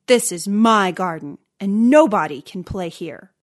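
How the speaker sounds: loud, possessive giant; angry shout. A narrator's reading. Giant banning children from his garden, angry shout. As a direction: lay down the law loudly. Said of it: "he shouted".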